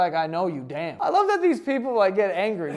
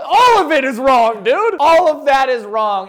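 louder, emphatic correction; 7 semitones higher, 12 dB louder than usual. Real, not acted. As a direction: call it wrong, loud and firm. Loud correction.

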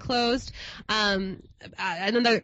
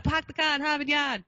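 quick attempt at a Boston accent, pitched up; 6 semitones higher than usual. Shown as a demonstration. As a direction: try a token Boston accent on request. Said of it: "do a Boston accent".

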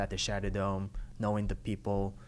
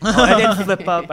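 laughing; 10 semitones higher, 18 dB louder, slower than usual. Real, not acted. Laughing while telling.

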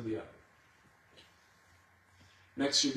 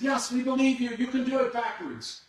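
crowd answering; 8 semitones higher than usual. Retold or imitated. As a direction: call back as the believing crowd. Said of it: "And they said".

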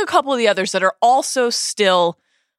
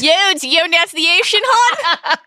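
high-pitched, hammed-up Baltimore accent; 9 semitones higher, 4 dB louder than usual. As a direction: play a Baltimore local, pitched way up. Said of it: "really hear the Baltimore accent come out".

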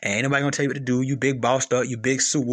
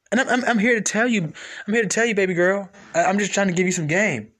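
normal talking voice

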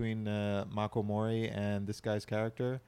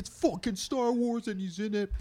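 much higher, freaking out with joy; excited fans; 14 semitones higher, 4 dB louder than usual. Retold or imitated. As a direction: squeal it like a thrilled fan. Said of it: "They're like".

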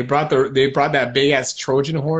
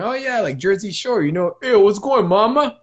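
put-on higher, brash Jersey Shore Italian American accent; 5 semitones higher than usual. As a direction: greet a girl Jersey Shore style, higher. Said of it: "that's not an Italian accent".

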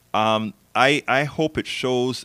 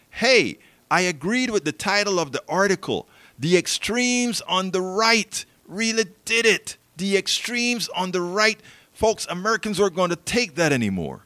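mocking voice; 9 semitones higher than usual. Mocking the article's framing.

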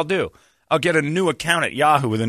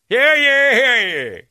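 loud, high proclaiming call; town crier; 12 semitones higher, 6 dB louder, much slower than usual. Retold or imitated. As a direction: announce it like a colonial town crier. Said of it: "in character".

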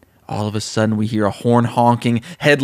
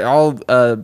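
ordinary talk, nothing marked

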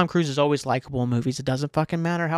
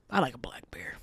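hushed confiding whisper; 3 semitones lower, 8 dB quieter than usual. Real, not acted. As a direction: breathe it like a secret. Confiding bear preference.

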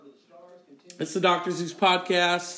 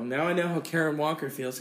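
usual speaking voice

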